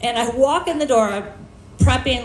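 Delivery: ordinary delivery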